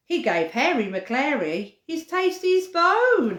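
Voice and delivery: lively, sing-song picture book reading; storybook voice for little ones; reading to children. Acted. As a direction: read it to toddlers with bounce.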